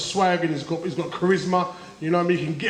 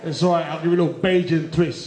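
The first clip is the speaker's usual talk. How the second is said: ordinary talk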